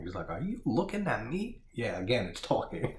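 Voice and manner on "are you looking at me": lightly voiced Taxi Driver line, much higher pitch; a mock De Niro challenge, pitched up; De Niro impression. Repeating the movie gag to viewers, higher mock De Niro. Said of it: "He's doing the De Niro".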